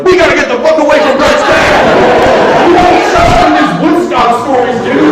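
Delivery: high, loud panicked yelling; panicked shout. Retold or imitated. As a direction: yell it like you must flee now. Panicked escape from Brett's dad.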